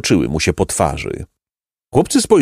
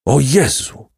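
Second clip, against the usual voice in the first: cried out; 5 semitones higher, slower than usual. A narrator's reading. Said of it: "krzyknął zbójca".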